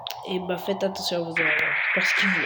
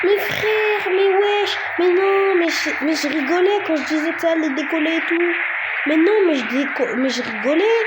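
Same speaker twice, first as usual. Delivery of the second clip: high, raised-voice objection; incredulous protest; 7 semitones higher, 4 dB louder than usual. Real, not acted. Streamer insisting it was only a joke, incredulous protest. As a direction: protest loudly in disbelief.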